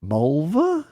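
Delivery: slow, put-on hesitant guessing; Jerry guessing. Friend acting out Jerry's wrong guesses at her name. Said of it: "he's like".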